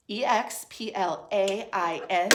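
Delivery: measured letter-by-letter spelling; spelling aloud. Shown as a demonstration. Teacher showing how we spell explain, spelling aloud. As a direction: spell each letter out distinctly. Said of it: "is how we spell explain".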